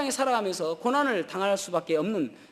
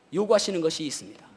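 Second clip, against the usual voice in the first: ordinary talk, nothing marked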